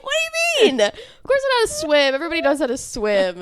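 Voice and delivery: high, fast, offended protest; indignant protest. Retold or imitated. To her doubting family, a high offended protest. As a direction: insist you obviously can swim.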